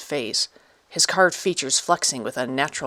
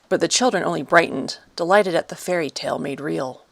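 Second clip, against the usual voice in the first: ordinary delivery, nothing marked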